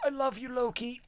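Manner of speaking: high, soft last words; hammed-up deathbed farewell; mock dying father.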